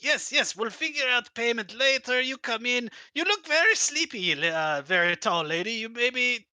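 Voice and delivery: high, eager broken-English host; dictator voice. Acted. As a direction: herd the guests along, overly welcoming. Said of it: "this dictator voice".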